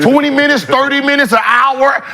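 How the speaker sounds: high-pitched, worked-up protest; heated challenge. Retold or imitated. Acts out challenge to time limit.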